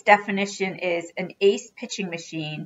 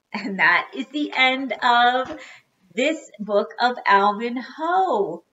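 slow, raised, drawn-out closing announcement; grand sign-off; 4 semitones higher, slower than usual. Real, not acted. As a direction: stretch the ending out with a flourish. Announces the end of the book.

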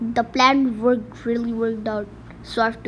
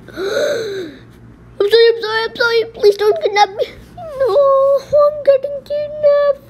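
much higher and louder, panicked pleading; hammed-up terrified victim crying sorry; scared kidnap victim; 13 semitones higher, 6 dB louder than usual. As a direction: beg for your life, near tears. Kid voices kidnap victim.

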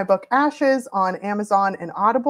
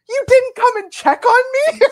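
lightly hammed, much higher and louder indignant whine; much higher hurt complaint, playful; mock hurt whine; 8 dB louder than usual. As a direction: imitate an offended husband, mock outrage. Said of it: "he was so mad".

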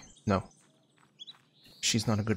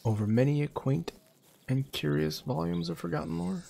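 theatrical recital; 6 semitones higher than usual. Acted. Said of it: "reads poem in heightened voice".

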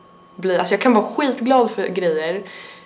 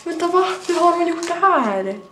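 higher, excited surprise; surprised gasp; 9 semitones higher than usual. Real, not acted. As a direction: gasp at the gift, thrilled. Opens surprising gift. (överraskad och upprymd)

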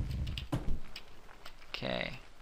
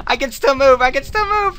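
high breathless relief, rising; excitedly yelling while escaping; being chased; 10 semitones higher, 3 dB louder than usual. Real, not acted. Still escaping the danger.

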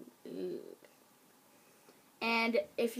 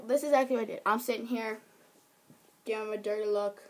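ordinary talk

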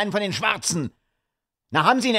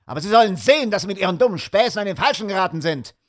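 fast, higher, hot-tempered rant; furious; 6 semitones higher, faster than usual. A narrator's reading. Sir Henry rants at Holmes about his shoe, fast and furious. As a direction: rattle off the threats in a rage. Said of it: "Er war so wütend".

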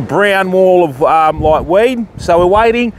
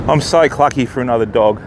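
usual speaking voice

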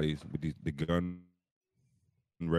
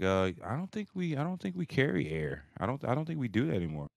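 clerk voice; 7 semitones higher, 4 dB quieter than usual. Retold or imitated. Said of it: "she's like".

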